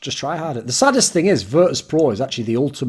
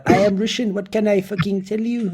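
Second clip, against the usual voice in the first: higher-pitched French accent passed off as Russian; bad French-accent impression, pitched up; 7 semitones higher than usual. Shown as a demonstration. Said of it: "I can just do the French one and saying, "Imagine this guy's Russian".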